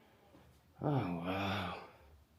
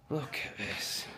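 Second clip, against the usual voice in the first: whispered awe; 13 dB quieter than usual. Real, not acted. Whispering in awe at the fireplace room. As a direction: whisper in hushed amazement.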